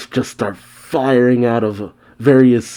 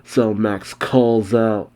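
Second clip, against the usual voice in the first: plain reading voice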